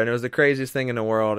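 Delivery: ordinary talk, nothing marked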